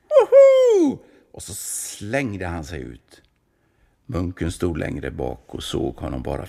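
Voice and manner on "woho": very high, loud joyful whoop; whooping yell. A narrator's reading. Kulan leaping off the tower with a joyful whoop. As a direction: let out a wild whoop. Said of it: "gav han till ett tjut".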